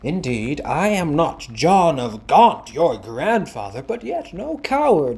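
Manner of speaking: loud offended protest; indignant shout. Acted. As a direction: bluster to defend his honor.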